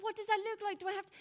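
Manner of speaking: put-on high, loud, panicked boy; lost boy asking in disbelief; bewildered boy. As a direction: play the startled boy, voice shooting up. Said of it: "And he's like".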